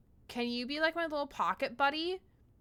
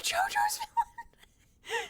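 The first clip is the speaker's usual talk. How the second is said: very high, slow, theatrical; over-the-top high delivery; dramatic anime voice; much slower than usual. Acted. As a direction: ham up a dramatic anime line. Joking comment on a character.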